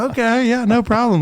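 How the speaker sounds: high, cheery mock voice of the supply guy; mock squadron guy. Squadron guy answering a friend who wants supplies, in a high, breezy voice. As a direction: toss off an easy, high "no problem". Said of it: "And he was like".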